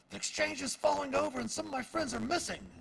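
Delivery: high urgent shout across the crowd; shouting miner. Acted. Human miner shouting toward the townmaster's home, high panicked shout. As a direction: yell it out in a panic. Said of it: "kinda shouts out".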